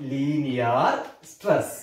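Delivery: slow repeat. Shown as a demonstration. Teacher writing the term on the board, slow low repeat.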